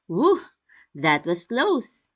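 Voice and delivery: a near miss, breathe out in relief; relieved exclamation. Shown as a demonstration. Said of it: "examples of exclamatory sentences".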